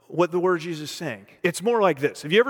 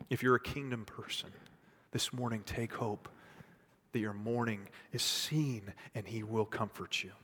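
low, soft word of comfort; quiet, consoling appeal; hushed preaching; 7 semitones lower, 14 dB quieter than usual. Real, not acted. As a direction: bring it right down and reassure them gently. Preacher gently consoles congregation.

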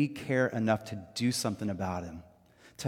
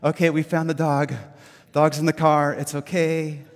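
loud relieved sigh of a worried owner; overwhelmed relief, nearly crying; relieved exclaim; 8 dB louder, slower than usual. Retold or imitated. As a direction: let the fear drain out as you find the dog. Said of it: "And I'm like".

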